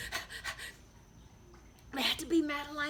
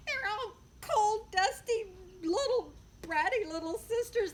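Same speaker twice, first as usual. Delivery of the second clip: higher, lightly voiced disdain; a hint of scolding sisters; 9 semitones higher than usual. A narrator's reading. Big sisters complaining about little sisters, mild disdain. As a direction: sneer at the little ones.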